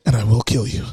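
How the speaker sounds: very low, deliberate movie-hero threat; hammed-up deep, menacing vow; Liam Neeson in Taken. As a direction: drop the voice right down and threaten like an action hero. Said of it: "Did he say, "I will kill you"?".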